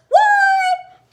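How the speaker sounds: sudden high squeal of disbelief; shocked delight. Real, not acted. Vlogger reacting to passing thirteen hundred subscribers, amazed squeal.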